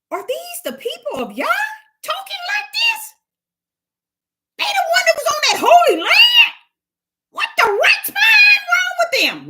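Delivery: scornful heathen. Acted. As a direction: outsider jeering in outraged amazement. Heathens mock the people's talk.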